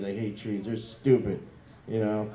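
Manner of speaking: ordinary talk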